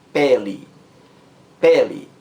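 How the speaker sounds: "pele" with a firm first syllable; stress on first syllable. Shown as a demonstration. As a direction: lean on the PE of "pele". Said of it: "A sílaba mais forte é a primeira".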